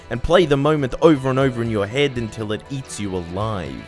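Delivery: ordinary talk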